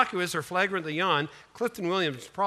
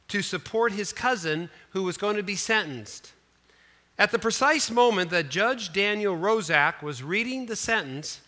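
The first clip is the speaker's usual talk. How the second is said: normal talking voice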